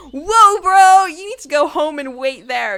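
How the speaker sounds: high, loud mock protest; startled objection. She stops him at her door, loud mock protest.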